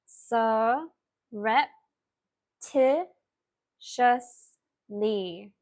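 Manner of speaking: SURREPTITIOUSLY in slow, separated syllables; said slowly. Shown as a demonstration. Teacher repeating the word for spellers on request, very slowly. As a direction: pause after each syllable of "Surreptitiously". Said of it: "let me say it slowly".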